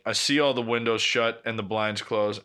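usual speaking voice

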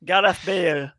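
put-on Welsh accent, pitched a little up; best Welsh accent. Urging on the Welsh footballer, mock Welsh accent. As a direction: cheer him on in an amateur Welsh accent. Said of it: "That's my best Welsh accent".

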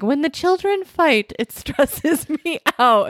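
high mock worried voice. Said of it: "with me being like".

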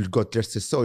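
normal talking voice

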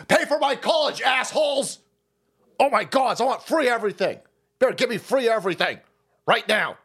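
whine-shout like a spoiled student; mock Bernie bro. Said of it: "one of those people".

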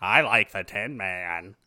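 put-on slow, dopey child voice, dropping low; goggles kid. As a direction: drone it out dreamily and slowly. Odd goggles kid imitated.

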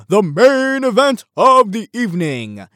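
big, high, booming announcer call; ring announcer. Acted. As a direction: announce the headline bout to a whole arena. Announces show's main segment like ring announcer.

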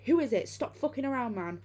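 loud, high yell through the door. Retold or imitated. Friends yelling at the thing outside the door, terrified loud shout. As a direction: shout it out, scared and angry. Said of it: "We keep yelling outside".

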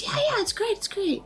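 very high eager voice; high gushing agreement. Retold or imitated. Michelle agreeing eagerly, pitched very high. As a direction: squeal out the agreement. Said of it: "And she goes".

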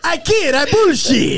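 high-pitched quote through laughter; laughing. Real, not acted. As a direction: imitate the opponent while cracking up. Cracking up imitating Lee.

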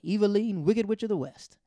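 high, loud jeering call of a name; mocking mean boss. Boss jeering at her employee, loud and high. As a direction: sneer it out like a bully boss. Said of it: "she was like".

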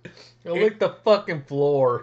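slow, puckered over a sour taste; eating sour candy. Real, not acted. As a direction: drag it out through the sourness. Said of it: "It's so sour".

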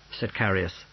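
ordinary delivery, nothing marked

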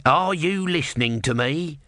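understated tortoise shout; mild shout. A narrator's reading. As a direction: call out high for attention. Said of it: "shouted the tortoise".

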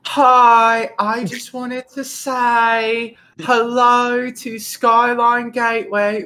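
high, drawn-out British greeting; singled-out British lady voice, slowly gushing; British lady impression. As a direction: imitate a British neighbour, slow and high. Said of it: "there's a British lady that lives".

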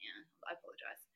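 low, soft, fast embarrassed apology; sheepish apology. Retold or imitated. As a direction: mumble the sorry quickly and low. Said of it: "I was like".

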